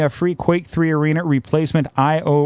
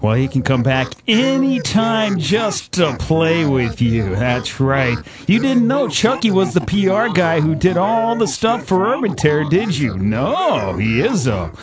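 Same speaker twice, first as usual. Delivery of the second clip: evil voice. Acted. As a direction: menace them like a horror villain. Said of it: "You guys like my evil voice".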